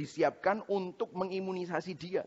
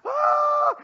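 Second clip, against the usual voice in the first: very high, long, loud shriek; imitated piercing wail of pain; child's pain scream; 9 dB louder than usual. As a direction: scream like a toddler pricked by a needle. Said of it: "pada waktu jarum pertama dimasukkan".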